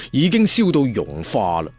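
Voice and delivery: ordinary delivery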